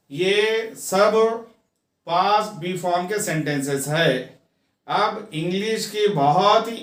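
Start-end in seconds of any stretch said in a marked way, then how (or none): none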